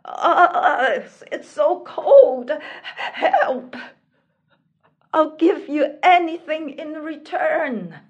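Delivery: loud, high plea for help, freezing; despairing cry. Acted. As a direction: cry out desperately through the cold. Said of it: "the prince cried out in despair".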